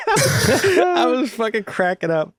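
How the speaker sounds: cracking up while talking; laughing hard. Real, not acted. Laughing at the segregated tweet.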